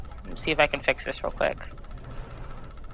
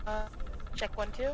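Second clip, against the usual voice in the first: quiet mic; 4 semitones higher, 11 dB quieter than usual. Real, not acted. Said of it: "Yeah, a little soft".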